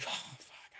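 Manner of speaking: breathed curse under the breath; whispered dismay. Retold or imitated. Officer hearing alarms, not knowing what to do, whispering. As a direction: reenact a helpless whispered swear.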